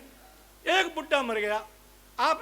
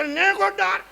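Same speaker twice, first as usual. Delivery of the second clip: higher, louder push for more ghee; insistent urging; 8 semitones higher, 7 dB louder, slower than usual. Acted. Mourner loudly urges the priest to pour ghee. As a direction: press him to pour more.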